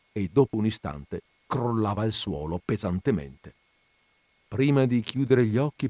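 ordinary delivery, nothing marked

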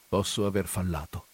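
mild, conciliatory apology; softened voice. A narrator's reading. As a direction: soften toward the priest. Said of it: "con voce raddolcita".

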